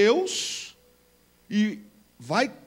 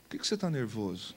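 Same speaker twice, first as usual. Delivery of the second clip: God speaking gently and softly to Cain; softer, lower, unhurried fatherly question; soft gentle voice; 11 semitones lower, 10 dB quieter, faster than usual. Acted. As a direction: coax him softly, like a calm friend. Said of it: "Deus veio com a voz suave".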